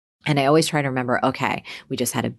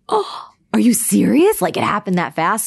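amazed disbelief; 6 semitones higher, 5 dB louder than usual. Retold or imitated. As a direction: gasp at how fast it sold. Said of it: "I was like".

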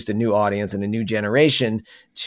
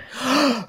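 dramatic gasp; 12 semitones higher, 4 dB quieter than usual. Acted. Dramatic gasp before royal decrees.

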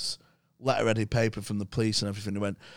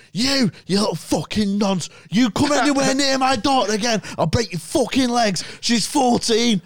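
high, loud furious threat from a car; much higher and louder public shouting; Steve-O yelling; 13 semitones higher, 7 dB louder than usual. Retold or imitated. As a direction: bellow it across the crowd.

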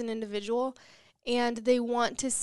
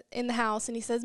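ordinary delivery

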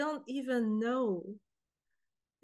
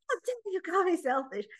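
higher, a touch of retold outrage; mock indignation; 6 semitones higher than usual. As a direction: replay the offended comeback, half-joking. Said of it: "I was so upset to be called selfish".

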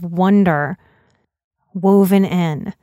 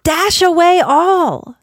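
lilting storybook reading of a holiday poem; rhythmic, cosy reading voice; poem read aloud; 3 semitones higher than usual. A narrator's reading. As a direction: read it like a bedtime Christmas poem. Reads a Christmas poem aloud.

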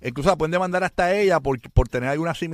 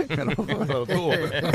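laughing; 8 semitones higher than usual. Real, not acted. Laughing at own joke.

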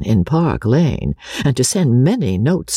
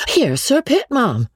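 high-pitched startled announcement; urgent, high warning to the mistress; alarmed cry; 13 semitones higher than usual. A narrator's reading. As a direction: call it out in alarm, pitch leaping up. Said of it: "crying out in an alarmed tone".

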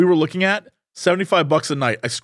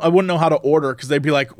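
normal talking voice

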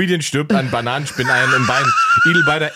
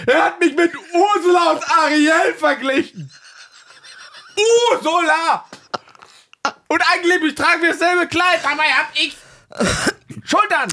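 whiny complaining; 12 semitones higher than usual. Pretend. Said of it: "Wieso verklagen sie?".

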